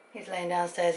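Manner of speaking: ordinary talk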